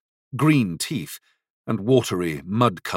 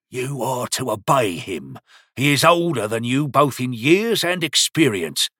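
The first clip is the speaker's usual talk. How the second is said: put-on old grandfather voice; 3 semitones higher than usual. A narrator's reading.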